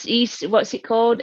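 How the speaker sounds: ordinary delivery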